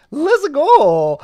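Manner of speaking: high, very loud strained cry; squeaky Mario-like voice. Acted. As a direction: yelp it like Mario kicked in the groin. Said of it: "sound like Mario has been kicked".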